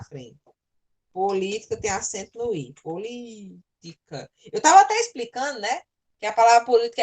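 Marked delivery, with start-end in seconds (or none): 2.8-4.3 soft, very slow syllables; drawn-out sounding out. Real, not acted. Teacher writing the corrected word, softly drawn out. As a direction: stretch it quietly while writing.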